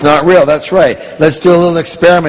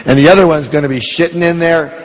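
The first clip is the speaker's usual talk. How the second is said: normal talking voice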